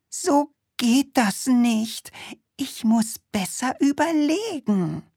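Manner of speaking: pondering witch. Acted. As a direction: mutter it like a thoughtful witch. Witch resolves to think.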